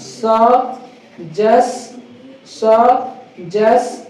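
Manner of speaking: "सजेस-" very slow, split as sə... jes; syllable by syllable. Shown as a demonstration. Teacher drilling part of a word, "सजेस-" very slowly in separate syllables. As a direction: break "सजेस-" in two with a long gap. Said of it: "इसको बोलेंगे क्या?".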